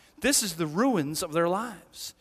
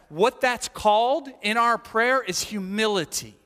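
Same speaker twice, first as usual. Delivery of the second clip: ordinary delivery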